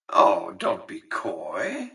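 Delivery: slow, drawn-out chiding, swooping up; knowing tease. Acted. Boss coaxing a recruit to admit ambition, knowingly teasing. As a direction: draw it out as a sly reproach. Teasing the listener's false modesty.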